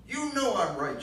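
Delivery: put-on high, loud bitter accusation in a southern accent; bitter southern mother. Ma blaming Gramps for Gran's death, loud southern bitterness. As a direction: spit the blame out in a shrill Southern voice. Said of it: "that nasty tune of hers".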